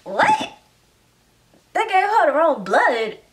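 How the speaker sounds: stunned outrage at the news; shocked disbelief. Retold or imitated. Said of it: "you're like".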